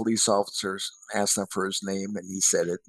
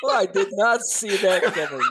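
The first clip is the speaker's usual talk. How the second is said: high-pitched, talking through laughter; real amused disbelief; laughing surprise; 10 semitones higher, 3 dB louder than usual. Real, not acted. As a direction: say it while still cracking up. Reacting through laughter to punchline.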